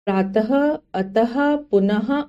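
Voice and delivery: प्रातः, अतः, पुनः ending in an audible "ha"; visarga as h. Shown as a demonstration. Teacher giving visarga examples, प्रातः, अतः, पुनः ending in breathed ha. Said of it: "ह की तरह होता है".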